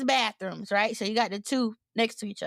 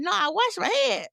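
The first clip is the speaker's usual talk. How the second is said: insistent denial; 7 semitones higher than usual. Retold or imitated. Retold insistence to stranger.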